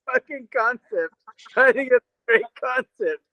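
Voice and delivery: high giggly voice through laughter; can barely get the words out for laughing. Real, not acted. Laughing at a friend's joke, high and giggly. Laughing about noodles and things.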